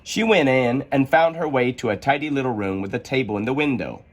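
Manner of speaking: plain reading voice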